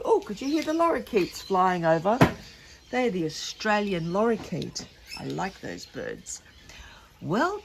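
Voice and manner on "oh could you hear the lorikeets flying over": usual speaking voice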